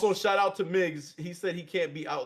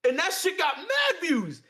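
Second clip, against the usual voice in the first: excited shout; 9 semitones higher, 4 dB louder than usual. Real, not acted. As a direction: crow it at your friend, stretching the words. Excitedly insisting to friend over promo.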